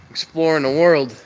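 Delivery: normal talking voice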